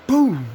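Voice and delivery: high, loud yelled boom; shouted gunshot boom. Retold or imitated. Telling friends about a bullet hit, loud shouted boom. Imitating bullet hitting him.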